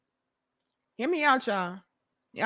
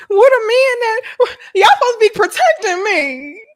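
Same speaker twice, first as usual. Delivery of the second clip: mock crying wail; 12 semitones higher, 8 dB louder than usual. Women mocked crying for the men to protect them, high and loud. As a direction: wail it like a helpless crybaby.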